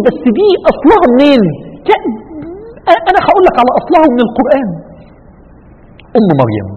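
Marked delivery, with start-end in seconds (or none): 0.1-1.4 higher, louder voice of a doubting listener; imagined questioner. Retold or imitated. A hint of a curious listener challenging the preacher. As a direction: play the curious listener asking. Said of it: "هتقول لي".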